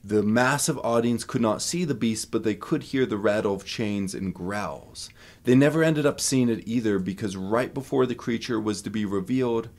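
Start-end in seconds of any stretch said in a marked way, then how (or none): none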